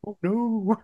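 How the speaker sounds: high, soft mock "oh no"; mock dismay. Reacting to the shower scene video, mock dismay. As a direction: imitate dismay in a small, high voice.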